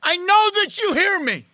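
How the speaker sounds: loud, high impassioned prayer; crying out. Acted. David crying out to God that he is heard, loud and high. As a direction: cry it out to God at full voice. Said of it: "David is in this place of crying out to God".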